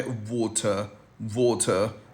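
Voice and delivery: वाटर said the British way, /ˈwɔːtə/, with no r; वाटर in British English, t clear and the r dropped; वाटर, British pronunciation demo; British water. Shown as a demonstration. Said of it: "British उसे बोलता है".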